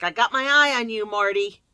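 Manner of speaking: loud mock warning at a robot; joking yell. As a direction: yell it out to get a laugh. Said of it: "I always yell at the thing".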